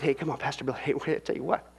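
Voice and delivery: a listener objects to Pastor Bill, mock skeptical voice; mock doubter voice. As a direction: brush him off like a doubter. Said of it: "But you might".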